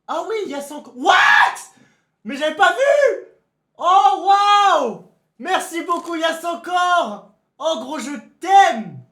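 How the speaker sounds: aigu et fort, crié de joie, étiré; déborde de gratitude en criant; remerciements exaltés. Real, not acted. Remercie le raid, ravi. (remercie Yass en criant de joie, aigu et fort)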